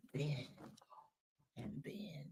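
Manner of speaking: breathy whisper, barely voiced. Real, not acted. Streamer whispers to chat so they could fall asleep. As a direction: whisper it as if lulling the chat to sleep. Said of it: "I'll whisper".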